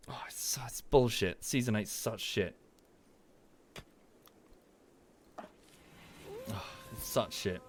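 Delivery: hushed, voiceless grumble; whispered disgust. Real, not acted. Grumbling to himself about season eight, whispering. Muttering disgust after apologising to viewers.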